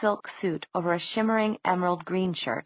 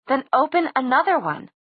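high-pitched, urgent insistence; shrill demand; 7 semitones higher, 3 dB louder than usual. A narrator's reading. Clary pressing Magnus to open a portal, shrill. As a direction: demand it in a raised, pleading pitch.

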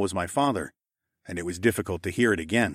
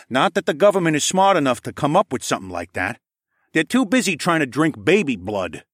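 put-on brash old man voice; 6 semitones higher, 4 dB louder than usual. A narrator's reading. Saul voiced in narration.

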